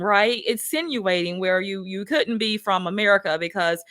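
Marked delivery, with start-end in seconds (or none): none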